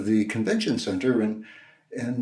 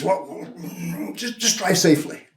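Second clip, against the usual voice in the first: imitated flustered stammer, pitched up; stunned; 8 semitones higher than usual. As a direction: fumble for words like someone taken aback. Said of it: "he was rather stunned".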